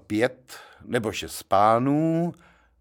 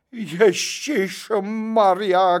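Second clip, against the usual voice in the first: high, slow, loud cry of dismay; shouting; 10 semitones higher, 4 dB louder, much slower than usual. Acted. Gentleman downstairs cries out in dismay. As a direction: wail it out in horror. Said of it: "křičel pán dole".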